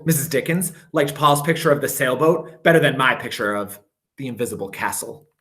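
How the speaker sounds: plain reading voice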